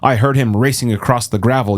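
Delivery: plain reading voice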